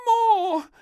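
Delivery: high, loud eager request; piping plea; little princess. A narrator's reading. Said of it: "said the little princess".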